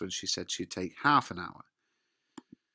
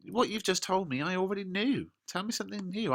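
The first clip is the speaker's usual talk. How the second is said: high-pitched impatient protest; mock exasperation; 10 semitones higher than usual. Juliet wound up by the nurse, acted exasperation.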